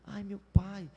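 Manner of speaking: mock plaintive moan; whiny grumbling. Preacher mimicking a believer bothered by sticky clothes, whining. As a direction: groan it like a put-upon complainer. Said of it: "você fica choramingando".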